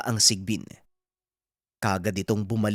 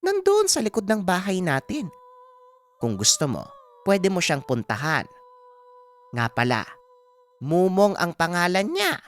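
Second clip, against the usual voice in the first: put-on old mother explaining, in a higher voice; helpful mother telling her son where to look; Aling Pasing; 9 semitones higher than usual. (matandang inang nagpapaliwanag, mataas ang tono)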